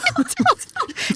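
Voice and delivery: bursting high-pitched laugh. Real, not acted. Cohost laughing at a joke about a sandy yard, high giggle. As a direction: break into a squealing laugh.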